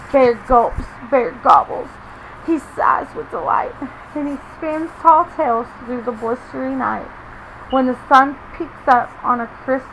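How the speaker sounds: put-on lively read-aloud to children; story reading. A narrator's reading. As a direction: read it like a picture book for little ones. Reading picture book to kids.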